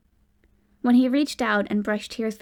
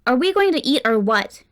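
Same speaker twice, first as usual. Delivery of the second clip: loud impatient snap; curt angry demand; snapped; 3 semitones higher, 7 dB louder than usual. A narrator's reading. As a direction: bite the words off, fed up. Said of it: "Cade snapped".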